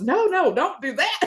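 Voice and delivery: high, loud urgent warning. Retold or imitated. Mother voicing the nurse halting the push, high and urgent. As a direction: stop her from pushing, high and sharp. Nurse stops her pushing.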